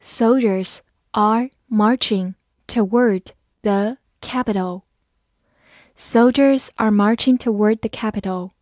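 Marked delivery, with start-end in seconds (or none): 0.0-4.8 slow, word by word; a teacher's slow model reading for learners to follow. Shown as a demonstration. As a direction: read it slowly with clear gaps between words. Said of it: "我们慢慢来读".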